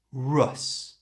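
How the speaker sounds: "rus" slow with a short uh vowel; schwa ending. Shown as a demonstration. Teacher breaking down the word ending. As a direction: say "rus" slowly with a relaxed uh. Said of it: "That's that little uh sound".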